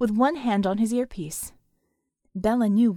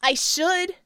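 high, raised cheeky reply; shouted; 7 semitones higher, 4 dB louder than usual. A narrator's reading. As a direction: shout back boldly. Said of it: "the girl shouted".

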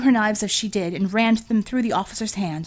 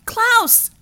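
very high, loud shriek of a name; 7 dB louder than usual. Acted. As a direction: scream his name across the battle. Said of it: "A female voice shrieked his name".

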